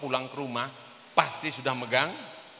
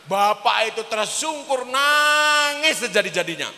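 loud, high, stretched cry of narration; dramatic storytelling; 9 semitones higher, 8 dB louder than usual. A narrator's reading. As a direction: swell into a high, drawn-out peak on the weeping. Preacher dramatizes the man's collapse. (bercerita dramatis, nada tinggi dan keras)

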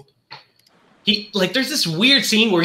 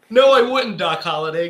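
ordinary talk, nothing marked

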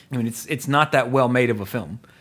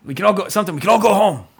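rousing speech; 6 semitones higher, 4 dB louder, faster than usual. Retold or imitated. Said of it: "he's like".

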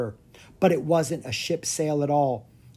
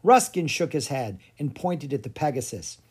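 ordinary delivery, nothing marked

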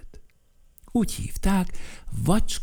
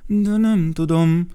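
sad; 8 semitones higher, 5 dB louder than usual. Acted. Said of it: "mondta szomorúan".

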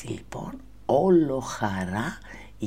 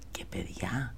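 ordinary delivery, nothing marked

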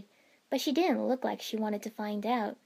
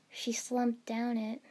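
ordinary delivery

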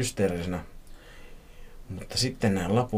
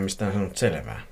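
plain reading voice

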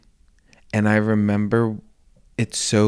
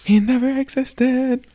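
much higher, mock amazed; high pitched echo. Real, not acted. Echo of the joke.

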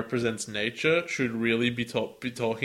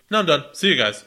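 louder, short dismissive sign-off; a touch of a curt train; 4 semitones higher, 6 dB louder, faster than usual. Acted. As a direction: brush them off and leave. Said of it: "he's just like".

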